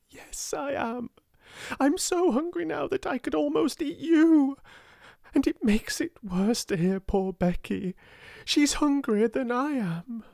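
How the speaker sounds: high, impassioned confession; passionate. A narrator's reading. Sarah breaking down and admitting her hunger, passionate. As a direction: let the held-back feeling burst out. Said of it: "she said in a new passionate way".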